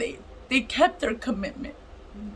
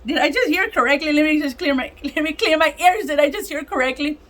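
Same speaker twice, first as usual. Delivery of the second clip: loud, higher-pitched laughing disbelief; incredulous; 5 semitones higher, 6 dB louder than usual. Retold or imitated. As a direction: re-enact stunned disbelief, louder and higher. Retelling her disbelief at free braces.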